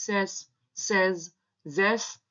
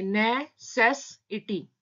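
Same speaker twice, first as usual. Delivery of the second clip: slow, syllable by syllable: ने-से-सि-टी; broken into syllables; 2 semitones higher, much slower than usual. Shown as a demonstration. Teacher splits the word for shorthand students, slow and syllabic. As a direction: spell out necessity slowly, each syllable apart. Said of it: "हम इसको break करके पढ़ेंगे".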